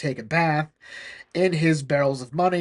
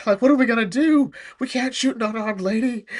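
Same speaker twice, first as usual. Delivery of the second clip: flustered soldiers; 7 semitones higher than usual. Retold or imitated. Soldiers fleeing the broom.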